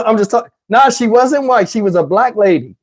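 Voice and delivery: ordinary talk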